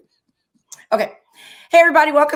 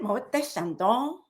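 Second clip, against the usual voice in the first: Moët Chandon said the French way, mo-eh shahn-don; French accent; 5 dB quieter, slower than usual. Pretend. French accent. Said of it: "Okay, with the accent".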